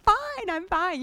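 high, bright forced-cheer "fine"; fake cheerful fine. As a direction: chirp it out with a big fake smile. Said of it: "That's what fine stands for".

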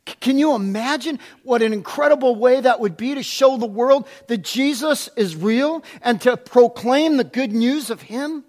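ordinary delivery

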